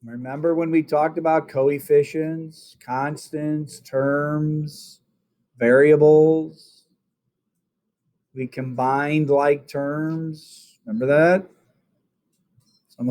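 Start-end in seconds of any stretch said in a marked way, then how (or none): 1.5-6.8 drawn out. Real, not acted. Teacher drawing out the list. 8.3-10.8 slow and deliberate. Real, not acted. Teacher stresses key phrase.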